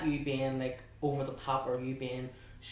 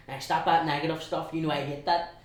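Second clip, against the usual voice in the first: louder, lightly voiced granny telling-off; a hint of a granny scolding; 3 semitones higher, 7 dB louder than usual. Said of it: "it was actually my granny".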